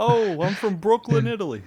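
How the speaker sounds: put-on New York Italian accent. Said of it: "New York Italian accent".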